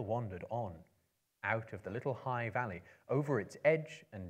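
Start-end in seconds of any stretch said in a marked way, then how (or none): none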